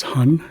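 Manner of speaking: hun read as in English. Shown as a demonstration. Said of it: "could be read as".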